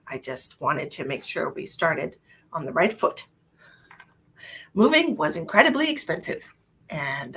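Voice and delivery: ordinary talk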